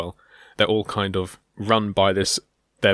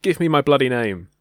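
louder, rude British punk demand; mock British punk demand; 4 semitones higher, 5 dB louder than usual. Host voicing the rude generator, British punk style. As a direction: demand it like a cocky Brit. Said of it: "the generator's a bit rude".

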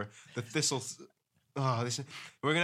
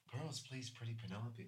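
put-on hushed, much softer demon-child voice; eerie, much softer horror-movie child; creepy horror voice; 15 dB quieter than usual. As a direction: murmur it like a possessed child in a horror film. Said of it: "sound like something from a horror movie".

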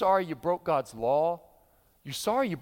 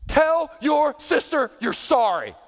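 stern parent; 7 semitones higher, 6 dB louder, slower than usual. Acted. Parent scolding child.

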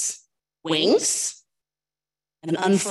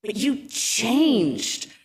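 slow, drawn-out hurt cry; plaintive cry; 4 semitones higher, much slower than usual. Acted. Homer, hurt that his friend changed, cries slowly and plaintively. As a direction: stretch it out, hurt and bewildered. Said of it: "cried Homer".

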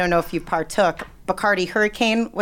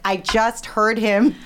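slow, pointed retold complaint; drawn-out mock exasperation; exasperated insistence; 3 semitones higher, 4 dB louder than usual. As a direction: press each word, acting out a fed-up caller. Retold argument with the wife.

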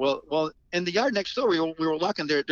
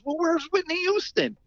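mock doubtful question. Bystander challenging [name].

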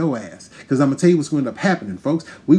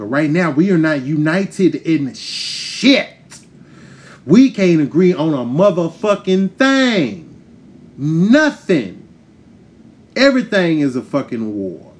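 slow, loud, pressing each word; heated rant; 3 semitones higher, 3 dB louder, slower than usual. Real, not acted. To the audience, fed up with division, loud and slow. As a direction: hammer it home at the crowd. Ranting at the crowd.

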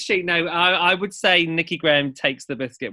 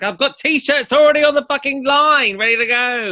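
1 mocking a queen; 10 semitones higher, 6 dB louder than usual. Mocking catchphrase-hungry queen.

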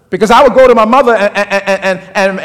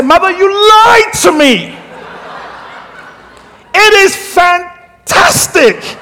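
much higher, slow, ending in a shout of delight; gleeful discovery; 8 semitones higher, much slower than usual. Retold or imitated. Grown son telling his mother she lied, thrilled and shouting. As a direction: announce it to mother like a thrilled teenager. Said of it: "And I said".

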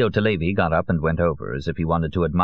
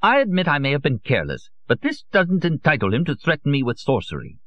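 indignant, higher-pitched protest; a touch of anger, higher; angry; 8 semitones higher than usual. A narrator's reading. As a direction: defend himself with rising irritation. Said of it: "Bramante said angrily".

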